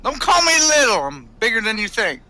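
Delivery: put-on high, loud Southern-accented leprechaun voice. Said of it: "we hear a southern leprechaun".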